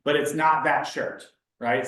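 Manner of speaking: usual speaking voice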